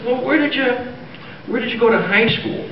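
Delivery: high Irish lilt; jokey stage Irish brogue; Irish accent. Acted. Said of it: "do I detect a bit of Irish?".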